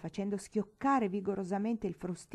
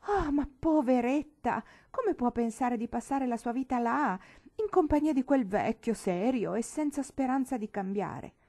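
commiserazione sgomenta; 5 semitones higher, 5 dB louder than usual. Acted. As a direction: pity the poor bride out loud, voice raised in pitch. Sorella commisera la futura sposa. (compatimento sgomento, voce acuta)

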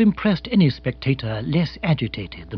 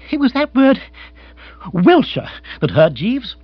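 high, loud aggrieved outburst; wounded lord; 10 semitones higher, 6 dB louder than usual. Acted. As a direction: aristocrat stung by an insult. Brooding Bill airs his hurt.

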